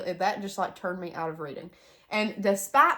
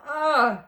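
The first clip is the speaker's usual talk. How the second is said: groan; 7 semitones higher, 8 dB louder than usual. Real, not acted. Allergies tearing her up.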